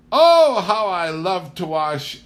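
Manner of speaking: high, slow, gushing delight; mock-rapturous guests sighing over the sink; gushing city guests. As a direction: gush like a charmed visitor from town. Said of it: "they said".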